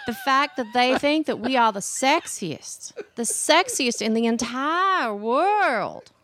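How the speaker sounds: playful, lilting Southern drawl; put-on Southern accent, hamming it up. Shown as a demonstration. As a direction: stretch the last words in a Southern accent. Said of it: "that's how you do the Southern accent".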